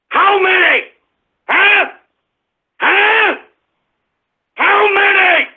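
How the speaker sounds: high, hollered angry questions; furious demand. Real, not acted. Host demands answers from disrespectful listeners, shouting furiously.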